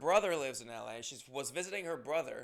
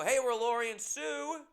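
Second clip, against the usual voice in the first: high, bright mock fan voice; chirpy imitation of two fans; quoting the fans; 9 semitones higher, 6 dB louder than usual. Comedian imitating fans greeting him after a show, high and excited. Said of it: "these two women come up and they go".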